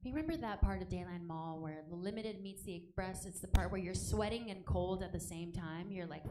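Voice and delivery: usual speaking voice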